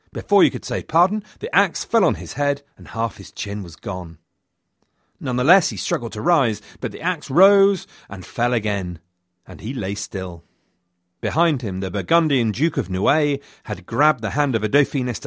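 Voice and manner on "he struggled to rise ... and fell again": ordinary delivery, nothing marked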